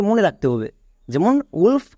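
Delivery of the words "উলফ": "উলফ" starting on short oo. Shown as a demonstration. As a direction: say "উলফ" without any wa sound. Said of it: "এর জন্য হ্রস্ব উ হচ্ছে". ("উলফ", ওয়া নয়, হ্রস্ব উ)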